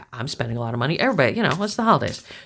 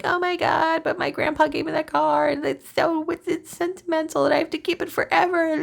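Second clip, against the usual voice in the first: much higher, whiny mock voice of a clingy car owner; high, mocking whine of sentimental attachment; 12 semitones higher than usual. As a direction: squeak it out like someone who can't let go. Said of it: "If you were like".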